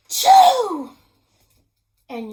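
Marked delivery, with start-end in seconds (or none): 0.1-0.9 sharp gasp then a loud, high chew; hammed-up explosive sneeze; huge sneeze. Wolf's sneeze blowing down the stick house, loud and hammed up. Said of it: "but I sneezed a great sneeze".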